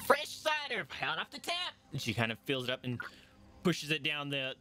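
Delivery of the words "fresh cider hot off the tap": much higher, put-on innkeeper voice; dwarf innkeeper voice. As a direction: offer the cider as the cheery dwarf barkeep.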